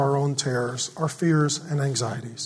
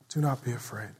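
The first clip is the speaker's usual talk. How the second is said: softer echo; 4 semitones lower, 9 dB quieter than usual. Shown as a demonstration. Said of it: "And he says".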